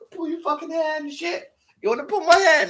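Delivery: high mocking imitation of the woman; mocking woman's voice. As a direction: mimic her in a squeaky taunting voice. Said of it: "Fucking violent woman".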